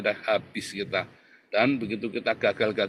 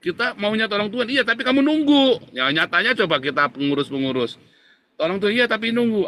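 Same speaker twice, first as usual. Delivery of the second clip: much higher and louder, ringing preacher's cry; fiery preaching; 9 semitones higher, 6 dB louder than usual. Acted. Pastor scolding the congregation about waiting, fiery preaching. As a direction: hammer the point home to the church.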